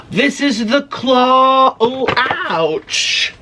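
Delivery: slow, high, amazed reveal; breathless wonder at the claw; awed. Real, not acted. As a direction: marvel at the giant claw.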